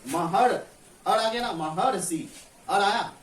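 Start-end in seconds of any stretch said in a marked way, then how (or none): 0.1-1.5 "महर" said slowly, each sound drawn out; a teacher spelling out "महर" sound by sound; showing the pronunciation. Shown as a demonstration. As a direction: stretch out "महर" so the "अर" is clear. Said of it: "हम लोग जब उच्चारण करते हैं तो क्या बोलते हैं".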